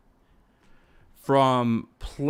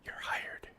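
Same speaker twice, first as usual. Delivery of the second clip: whispered reply; 13 dB quieter than usual. Pretend. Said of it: "And she goes".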